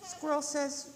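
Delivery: ordinary delivery, nothing marked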